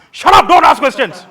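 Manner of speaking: sudden high, full-volume yell; furious, high-pitched silencing shout; angry shout. Acted. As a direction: explode and cut the questioner off. Acted silencing of a questioner.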